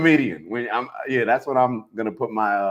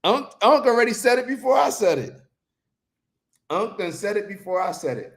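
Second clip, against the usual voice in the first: high, excited crowing to the crowd; hyped boasting; 10 semitones higher than usual. Real, not acted. Host hypes Unc to stream viewers, excited high crowing. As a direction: crow it in a high, worked-up voice.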